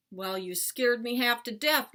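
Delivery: shouted scolding. A narrator's reading. Shaken dad scolding his son, shouted. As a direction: scold loudly after a scare. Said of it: "my dad shouted".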